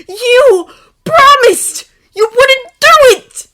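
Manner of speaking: slow, high, full-force yell; anguished yell. A narrator's reading. Draco confronting Harry over a broken promise, anguished yelling. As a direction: yell each word as an accusation. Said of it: "Draco yelled".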